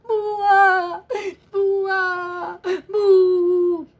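high wailing boo-hoo; mock baby crying. Storyteller wails like the crying baby elephant. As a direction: bawl like a little baby elephant.

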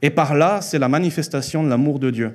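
usual speaking voice

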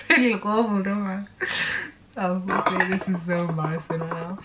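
soft choked-up voice; composing herself through tears; quiet embarrassed tearful laugh; real tearful. Real, not acted. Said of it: "why am I so emotional".

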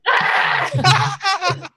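high, hammed-up startled scream; mock scream. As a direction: scream in comic fright. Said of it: "like, ah!".

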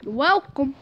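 ordinary delivery

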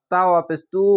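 ordinary delivery

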